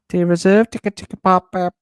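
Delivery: high and loud hammed-up Welsh; high, loud mock Welsh lyric. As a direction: belt out the silly lyric. Imitating Welsh lyric, laughing.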